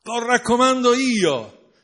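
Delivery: booming heavenly father vouching for his son; the Father's booming voice. Acted. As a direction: proclaim your son to the world. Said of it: "disse il padre".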